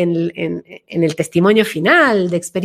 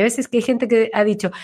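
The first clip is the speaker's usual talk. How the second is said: ordinary talk, nothing marked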